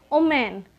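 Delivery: "omen" for women, starting on a rounded o; Bengali mispronunciation. Shown as a demonstration. Teacher repeating the example for learners, women mispronounced "omen". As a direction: drop the opening w and say "omen". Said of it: "like, Bengali people, many people say".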